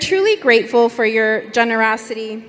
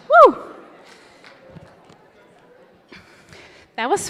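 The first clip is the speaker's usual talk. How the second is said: out of breath, huffing and puffing; winded, catching breath between words. Real, not acted. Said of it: "I apologize for the huffing and puffing".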